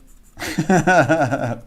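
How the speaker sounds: sudden high loud laugh. Real, not acted. Streamer laughs at a viewer testing the accent voice, loud laugh. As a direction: lets out a big laugh.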